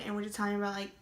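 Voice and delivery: ordinary delivery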